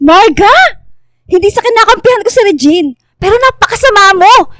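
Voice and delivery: loud, high furious scolding; angry shout. Acted. Friend scolds the pregnant rival, loud and furious. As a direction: tear into her at full voice. Heated confrontation in restaurant.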